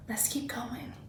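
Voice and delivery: soft whispered aside to move on. Real, not acted. Teacher whispers a transition.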